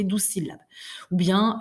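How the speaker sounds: ordinary delivery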